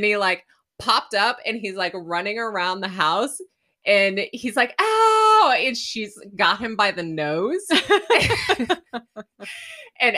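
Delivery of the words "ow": high, loud mock "Ow!"; hammed-up cry of pain; mock yelp of pain. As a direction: yelp like someone getting whacked. Retelling witch hunter being beaten.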